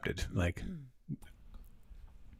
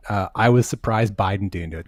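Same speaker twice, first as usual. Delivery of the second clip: ordinary talk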